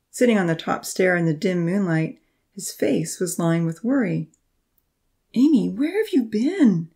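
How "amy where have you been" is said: high anxious question; worried, slow and pleading. A narrator's reading. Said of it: "he asked anxiously".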